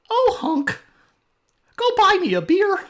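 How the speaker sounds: very high, loud squeaky cartoon mouse, fast; Mickey Mouse voice. Shown as a demonstration. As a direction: squeak the order at hunk. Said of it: "I don't do Mickey very well".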